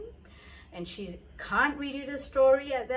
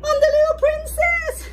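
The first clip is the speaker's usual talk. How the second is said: high, loud squeak on the last words; a comic twist in the voice; playful squeal; 10 dB louder than usual. A narrator's reading. Telling the potty's trick on the princess, playful high squeal. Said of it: "the potty played tricks on".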